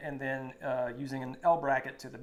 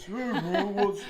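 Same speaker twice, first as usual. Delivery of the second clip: laughing, higher mock troll voice; a smug troll greeting, half laughing; 5 semitones higher, 6 dB louder, much slower than usual. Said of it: "That's my troll voice".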